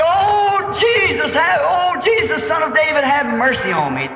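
high, pleading call, easing at the end; imploring shout of a blind beggar; crying out. Retold or imitated. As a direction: cry out for mercy to someone passing by. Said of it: "He cried".

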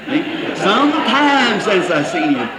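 slow, laughing repeat of the husband's words; mocking laughing echo. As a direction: tease him by echoing it with a laugh. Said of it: "Yeah, thirty minutes".

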